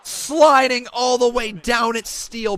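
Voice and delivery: high, shouted wrestling call; excited play-by-play. Real, not acted. Commentator watching Rollins hit the ladder, shouted play-by-play. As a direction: cry out the danger, stretching the slide. Calls the match live.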